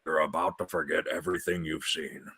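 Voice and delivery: lightly voiced robot hero, soft; a hint of a grave robot leader; Optimus Prime. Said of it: "I could picture Optimus Prime being like".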